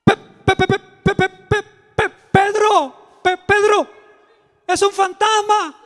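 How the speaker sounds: high, loud, stuttering terror; stammering in fear. Acted. Juan, terrified, stammers to Pedro about a ghost. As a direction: stammer out the name, scared stiff. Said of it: "con miedo".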